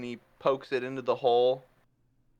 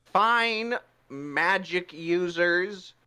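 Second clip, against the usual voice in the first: higher, very slow mock-gracious invitation; grudging Puss; 5 semitones higher, much slower than usual. Puss handing the box to the mages, slow and grudging. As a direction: yield with wounded pride. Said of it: "He's like".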